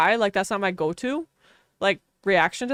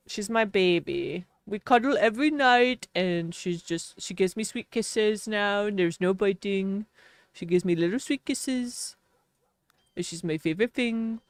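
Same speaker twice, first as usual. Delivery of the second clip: dog speak; 4 dB quieter than usual. Real, not acted. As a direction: coo it lovingly, much softer. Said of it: "That's how I talk to her".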